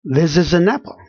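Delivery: "This is an apple" as one linked phrase; connected words. Shown as a demonstration. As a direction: glide from word to word in "This is an apple". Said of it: "going all the way connected".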